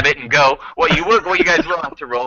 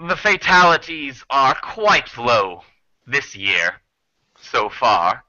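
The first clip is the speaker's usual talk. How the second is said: smooth, menacing reassurance; 3 semitones higher than usual. Acted. Creature assures travelers about fatalities, smoothly menacing. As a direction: reassure them with courteous menace.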